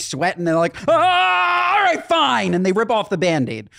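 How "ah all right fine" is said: reluctant rioter. Retold or imitated.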